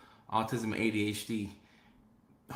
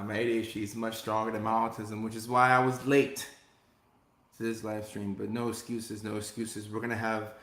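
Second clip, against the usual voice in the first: normal talking voice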